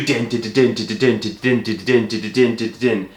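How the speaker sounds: rhythmic din-din clatter; train noise. Retold or imitated. Storyteller taking the train home, imitated train rattle. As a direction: chug along like wheels on rails.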